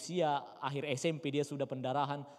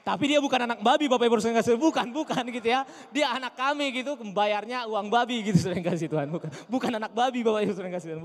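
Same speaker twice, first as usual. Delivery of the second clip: loud, high, amused insistence; laughing preaching; 9 semitones higher, 12 dB louder, faster than usual. Acted. Pastor laughingly insists to the congregation his son isn't a piglet. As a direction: shout it with a laugh in your voice.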